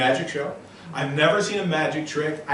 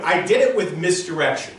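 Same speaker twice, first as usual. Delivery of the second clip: normal talking voice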